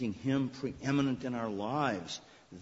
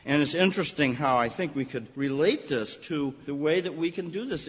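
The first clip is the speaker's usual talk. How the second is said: ordinary talk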